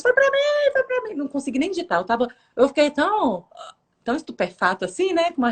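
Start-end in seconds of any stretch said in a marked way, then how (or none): 0.0-1.1 high-pitched, thrilled little outburst; excited reaction. Retold or imitated. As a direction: squeal it, overjoyed it was for you. Reage empolgada à resposta na live. (empolgada, com voz bem aguda)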